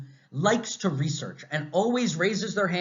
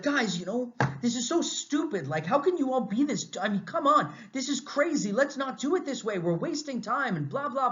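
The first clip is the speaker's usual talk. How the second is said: imitating a frustrated rant, pitched much higher; mock angry rant; 8 semitones higher than usual. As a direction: replay your own annoyed outburst at coworkers, voice up high. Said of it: "I talk like this".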